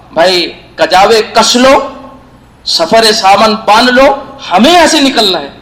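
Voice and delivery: loud retold marching order; calling out orders. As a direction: call out to a whole caravan, drawing each order out. Prophet announces departure to the caravan.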